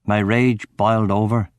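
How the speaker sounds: ordinary delivery, nothing marked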